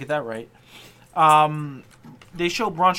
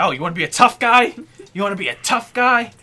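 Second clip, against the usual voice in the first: loud, punchy taunt; mock angry challenge; 3 semitones higher, 6 dB louder than usual. Boss challenging his wrestler, loud mock-angry taunt. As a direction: square up to him, hitting "tough guy".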